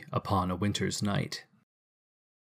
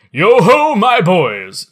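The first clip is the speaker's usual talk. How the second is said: much higher and louder hearty holler; jovial shout; 8 semitones higher, 11 dB louder, slower than usual. Acted. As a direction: call out the boys like a jolly master. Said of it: "cried old Fezziwig".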